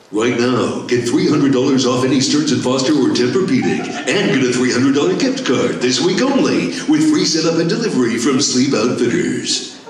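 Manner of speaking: Duke Nukem voice. Shown as a demonstration. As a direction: sell mattresses like a tough action hero. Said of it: "That's how Duke would do it".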